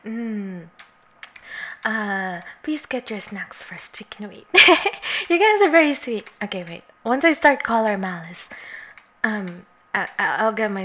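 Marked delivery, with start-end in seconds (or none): 2.6-4.5 whispered, gentle and unhurried; a real whisper to the chat; soft whisper. Real, not acted. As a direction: breathe it to the viewers kindly. Tells viewers to fetch snacks.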